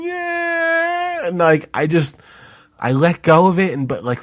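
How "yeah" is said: very high drawn-out "Yeah!"; pained yelp. Retold or imitated. Grabbing the thorny branch, high pained yelp. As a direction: cry out as the thorns stab. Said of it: "I'm like".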